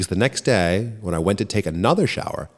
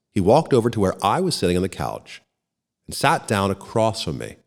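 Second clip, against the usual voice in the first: ordinary delivery, nothing marked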